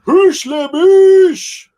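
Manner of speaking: high, loud, slow war yell; battle cry. Retold or imitated. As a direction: bellow it like a charging warrior. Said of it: "where that guy's like".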